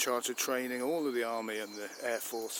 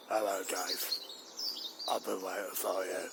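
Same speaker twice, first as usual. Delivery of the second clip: a calm, slightly menacing computer greeting; sinister AI voice; 3 semitones lower than usual. Acted. The dog Rover greets his owner Dave, a hint of menacing computer. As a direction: greet him like a cold machine.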